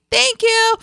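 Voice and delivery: high, loud, flustered squeak; awkward high thank you. Retold or imitated. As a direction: blurt it out high and embarrassed. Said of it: "so it came out like that".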